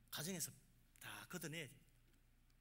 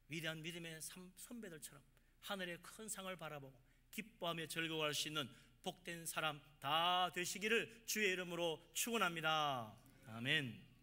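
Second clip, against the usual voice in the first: preacher's blessing; 6 dB louder than usual. Real, not acted. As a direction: swell into a sustained blessing and amen. Preacher pronounces closing blessing.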